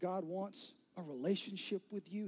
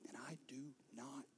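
soft unvoiced hush, tears close; tender whisper; 3 semitones lower, 9 dB quieter than usual. Jesus to the woman, tender whisper. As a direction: whisper it to her gently.

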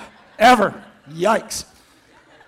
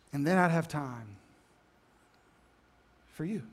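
fading confession; 6 semitones lower, 4 dB quieter than usual. Acted. Martha admitting to Jesus what she's really thinking, quietly fading. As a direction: let it sink to a quiet plea.

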